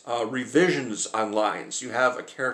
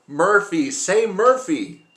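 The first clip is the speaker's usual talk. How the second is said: loud, high chant of the name, drawn out slow; mock crowd chant, shouted and stretched; crowd yelling; 7 semitones higher, 6 dB louder, slower than usual. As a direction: yell it like a cheering test audience. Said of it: "people in the audience started yelling".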